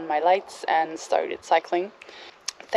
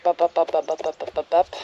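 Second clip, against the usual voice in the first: rapid "bup bup" heartbeat noises; imitated pounding heartbeat; faster than usual. Camper retelling her panic, imitating a pounding heart. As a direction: mimic a racing pulse, fading as it goes. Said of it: "my heart started pumping".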